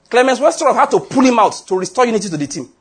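usual speaking voice

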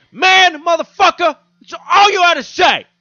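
hammed-up frustrated dealer blowing up; exasperated yell. Dealer storms off yelling at the clueless tourist. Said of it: "And then he goes".

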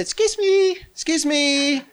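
put-on high, singsong excuse-me to a child; tentative, high-pitched hailing; getting her attention. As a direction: call gently high to a little girl. Recreates approaching crying little girl.

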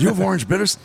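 high-pitched, urgent excited asking; retold excitement, pitch leaping up; eager question. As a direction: ask as if you can't believe your luck. Rushing the bar, thrilled at find.

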